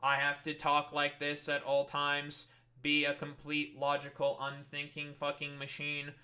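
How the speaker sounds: mock robot voice, level monotone. Sarcastic streamer answers critics of his emotion, in a robot monotone. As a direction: drone it evenly like an unthinking machine. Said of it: "like a robot like this".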